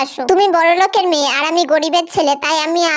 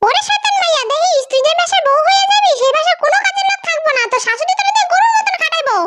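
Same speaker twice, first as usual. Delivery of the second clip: high-pitched nagging mother voice; a mother nagging her lazy daughter, higher; scolding mother; 8 semitones higher than usual. Acted. Mother scolds her daughter about housework, high-pitched and nagging.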